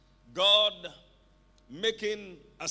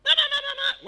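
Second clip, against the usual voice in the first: high, loud stuttering bleat; goat bleating; 12 dB louder, faster than usual. Retold or imitated. Preacher showing someone turned into a goat, loud high bleating. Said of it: "the next thing you hear is bleating".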